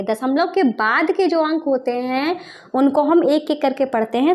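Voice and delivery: ordinary delivery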